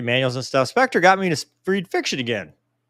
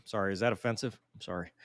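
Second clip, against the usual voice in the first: ordinary talk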